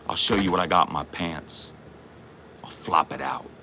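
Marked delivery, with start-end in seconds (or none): 2.6-3.5 whispered mock threat; taunting whisper. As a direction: hiss it low and menacing.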